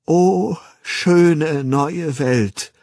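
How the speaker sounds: high, loud, very slow, faltering wonder; drawn-out declamation, wavering with emotion. A narrator's reading. Young man suddenly thinking of Lenina, voice wavering as he declaims. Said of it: "Seine Stimme wankte".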